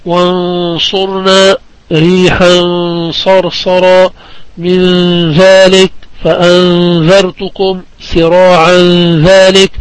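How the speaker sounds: slow examples, each ن in "وانصرنا", "من ذلك", "فأنذرتكم" held as a hidden nasal hum; ikhfa demonstration. Shown as a demonstration. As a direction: draw out the ghunna in "من ذلك" and "فأنذرتكم". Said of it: "فهذا هو الإخفاء، وأعطينا أمثلة".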